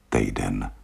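a quiet, resigned echo; sad. Acted. Trailing, quieter repeat.

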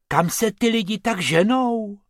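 high-pitched puzzled question; wondering. A narrator's reading. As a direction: ask in open wonder. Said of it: "divil se Bobek".

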